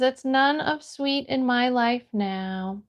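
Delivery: ordinary talk, nothing marked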